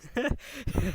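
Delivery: short high laugh; brief laugh after an interruption; awkward laugh. Real, not acted.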